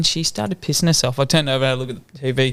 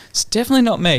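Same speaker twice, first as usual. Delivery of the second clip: higher, mock-innocent protest; defensive parent; 8 semitones higher, faster than usual. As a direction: deny it playfully. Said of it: "something's like".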